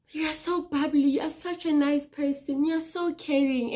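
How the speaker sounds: high, sing-song mock praise; mimicking cheerful people. Mocking the people who called her bubbly. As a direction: parrot the compliments in a lilting voice. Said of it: "people always said".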